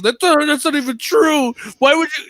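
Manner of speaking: defensive. Retold or imitated. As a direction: imitate a friend getting indignant and defensive. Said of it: "he starts getting all fucking defensive".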